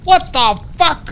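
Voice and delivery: very high, loud, stretched-out disbelief; astonished yell. Retold or imitated. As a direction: yell it high, like bumping into a lost buddy. Acting out startled reunion shout.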